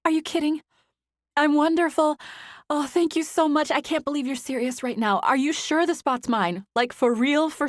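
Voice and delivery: high, loud, overjoyed gushing; excited. Acted. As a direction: burst with happy disbelief. Zoe overjoyed after the news.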